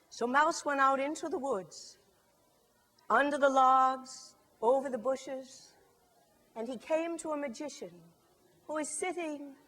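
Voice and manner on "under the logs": plain reading voice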